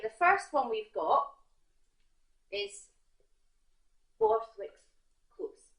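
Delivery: normal talking voice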